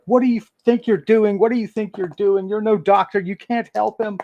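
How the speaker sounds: high, loud indignant yelling; angry shout. Acted. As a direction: shout him down in outrage. GM voices Burlow confronting Tegriv.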